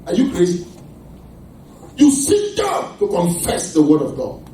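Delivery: usual speaking voice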